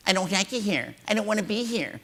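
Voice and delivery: lightly voiced whiner. Imitating whiners complaining.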